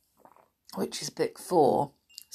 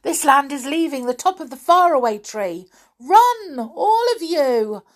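hurried call building to a high, loud command; urgent warning; 9 semitones higher, 8 dB louder than usual. A narrator's reading. Moonface hurries friends off the leaving land, urgent warning. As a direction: rush it, then shout the order. Moonface warns everyone to run.

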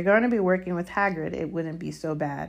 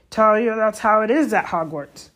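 loud, higher, cutting in; growled retort; 5 semitones higher, 9 dB louder than usual. A narrator's reading. Hagrid interrupting Malfoy's protest, growling. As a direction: cut the boy's complaint off crossly. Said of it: "Hagrid growled".

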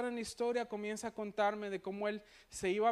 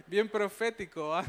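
self-mocking aside through laughter; laughing; 4 dB louder than usual. Real, not acted. Preacher joking to the congregation about his opening line, laughing. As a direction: laugh while teasing yourself, a bit louder.

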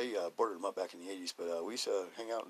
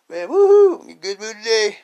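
high, loud joyful whoop; loud excited morning cheer; waking up happy; 13 semitones higher, 21 dB louder than usual. Retold or imitated. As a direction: burst out with glee on waking.

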